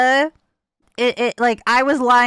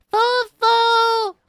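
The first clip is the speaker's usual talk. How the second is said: high, sing-song call; calling out a name; 12 semitones higher, 4 dB louder than usual. Real, not acted.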